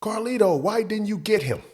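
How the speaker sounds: high mock yell across the store; retold yell. Store boss yells at the stock boy from his cage, high mock yell. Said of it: "so he yells out".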